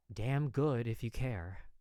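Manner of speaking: usual speaking voice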